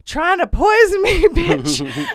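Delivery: high, loud, drawn-out mock fury; outraged accusation. Inmate accuses guard over open milk, shrill outrage. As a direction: shriek it, breaking into a laugh.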